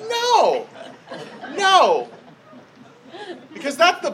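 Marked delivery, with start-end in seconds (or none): 0.1-2.1 high-pitched, protesting no; emphatic comic denial. Retold or imitated. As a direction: deny it twice with a pause, playing for laughs. Comic retelling of own answer.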